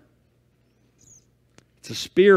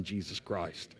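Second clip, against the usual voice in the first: ordinary talk, nothing marked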